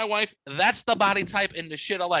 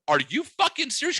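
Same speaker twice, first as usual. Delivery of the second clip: incredulous; 7 semitones higher than usual. Retold or imitated. Retold disbelief at friend.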